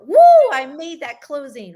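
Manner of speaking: loud, high whoop of triumph; excited cheer. Shown as a demonstration. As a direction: celebrate the win out loud. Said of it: "Or you feel that excitedness".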